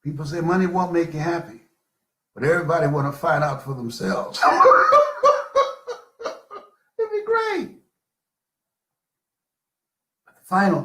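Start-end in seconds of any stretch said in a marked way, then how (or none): 4.4-5.9 a high, loud burst of laughter; big laugh. Real, not acted. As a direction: laugh out loud at your own joke. Laughs at own joke.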